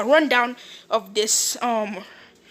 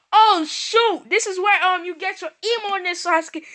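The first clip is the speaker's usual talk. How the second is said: excited teasing; 7 semitones higher, 3 dB louder than usual. Retold or imitated. Naruto teases Sasuke on meeting Indra.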